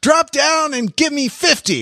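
high barked drill sergeant shout. Retold or imitated. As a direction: yell orders at the recruits. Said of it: "says the stereotypical drill instructor".

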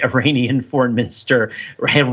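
normal talking voice

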